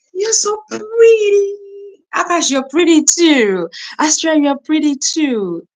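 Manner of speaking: high, loud, delighted gushing; excited high-pitched flattery; gushing compliment. Real, not acted. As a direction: coo loudly and high at them. Gushes compliments to viewers.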